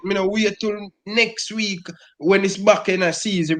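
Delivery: ordinary delivery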